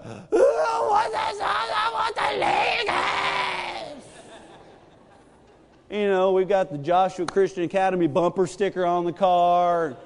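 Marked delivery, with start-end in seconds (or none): 0.0-4.0 put-on high wailing toddler, pouty cry shouted. Dad imitating his toddler's pouty wail over Legos. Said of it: "I'm talking about a pouty cry".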